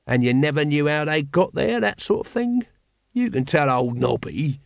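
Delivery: slow, knowing Nobby voice, sly and coaxing; sly old corporal. Acted. Nobby coaxes Carrot to confess a past crime, sly and slow. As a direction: wheedle a confession out of the lad.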